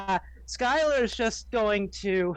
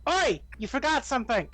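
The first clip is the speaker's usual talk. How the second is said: calling out; 6 semitones higher, faster than usual. Acted. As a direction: shout after him down the street. Calling after the leaving thief.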